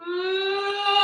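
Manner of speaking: high, loud mock wail; imitated child's wail. Teacher imitating the little girl's renewed crying, mock wail. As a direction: imitate a toddler starting to cry again. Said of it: "and then she'd go".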